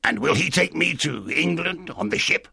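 high, eager castaway voice; strange castaway. Acted. Castaway asking the boy for the squire's help, strange high voice.

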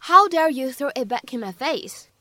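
ordinary talk, nothing marked

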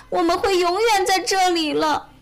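high-pitched tearful wail, acted. A narrator's reading. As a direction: play a crying little bear. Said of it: "他哭着说". (带着哭腔)

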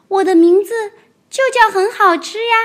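高高的童声，天真认真; 小孩一本正经地解释; 天真的小恐龙. Acted. 小恐龙角色对白. (天真的高音童声)

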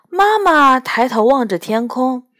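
usual speaking voice

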